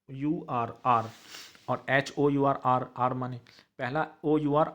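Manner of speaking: ordinary talk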